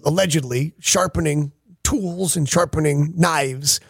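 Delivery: usual speaking voice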